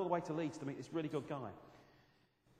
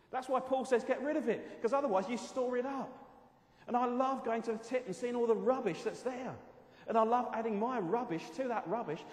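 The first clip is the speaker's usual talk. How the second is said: loud, high, driving preacher voice; fervent preaching; 7 semitones higher, 6 dB louder than usual. Acted. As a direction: push it out with full conviction.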